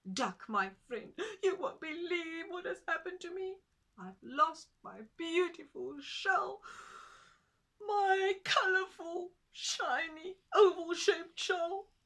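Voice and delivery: high, loud wailing tortoise voice; small tortoise lamenting, high and loud; Tutu the tortoise. Acted. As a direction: pitch it up and wail over the lost shell.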